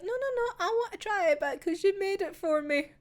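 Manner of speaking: put-on a small girl begging to try the pie; eager little girl. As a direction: insist like a sweet young girl. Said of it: "she's like".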